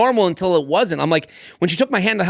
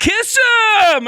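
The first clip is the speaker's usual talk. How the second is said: very high, stretched-out holler; mock an onlooker yelling encouragement; egging on; 6 dB louder than usual. Helped woman shouting at the couple, egging on. Imitating woman cheering second kiss.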